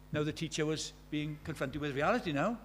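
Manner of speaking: normal talking voice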